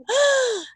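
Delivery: high, theatrical gasp; mock surprised gasp. Teacher reveals the next slide to pupils, mock gasp. Teacher dramatizes something appearing on screen.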